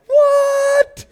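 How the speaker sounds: squeaky, very high astonished what; stunned what. Retold or imitated. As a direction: gasp in disbelief. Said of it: "the disciples are like".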